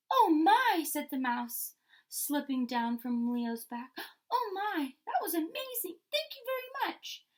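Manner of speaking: high, breathless little mouse voice; squeaky amazed mouse. Acted. Mouse thanking Leo after the leap, high and amazed. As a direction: gush like a tiny thrilled mouse.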